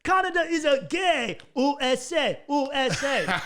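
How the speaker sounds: put-on loud, high, slow German-accented taunt; mock German kids' accent. German kids taunting the Canadian, loud high German-accented mockery. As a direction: jeer it like drunk German teens, laughing. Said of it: "they'd run over again".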